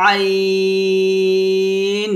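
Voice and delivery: drawn-out, pressed, thin আইন from mid-throat; thin Arabic ain. Shown as a demonstration. Teacher chanting the letter ain for the class. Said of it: "চাপ দিয়ে চিকন করে".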